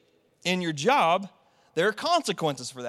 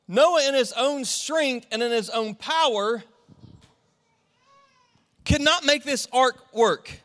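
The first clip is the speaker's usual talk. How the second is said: rising, higher and louder sermon climb; preaching at full force; 3 semitones higher, 4 dB louder than usual. Acted. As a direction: drive the point home to the congregation.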